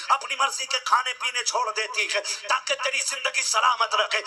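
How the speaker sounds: impassioned sermon building to loud peaks over music; full-force pulpit preaching about a mother's sacrifice; fiery preaching. Acted. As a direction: preach it hard to the young men. Preacher addresses youth about mothers.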